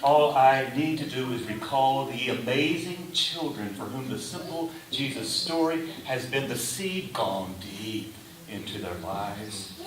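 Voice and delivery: ordinary talk, nothing marked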